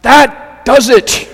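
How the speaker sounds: loud, high, drawn-out exasperated cry. Acted. Frazzled woman in a bath commercial cries out to the heavens, loudly. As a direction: cry it out at the end of your rope. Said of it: "and she cries out".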